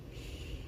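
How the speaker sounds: soft, unvoiced-breath "shh"; soothing shush. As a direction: hush someone crying very softly. Sun hushes crying Cyrus.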